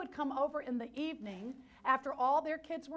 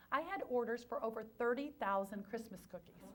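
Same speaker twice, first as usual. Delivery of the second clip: ordinary talk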